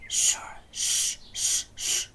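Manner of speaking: whispered shh, shh, shh; urgent hushed shushing. Acted. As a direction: hush them urgently in a whisper. Shushing in the barn.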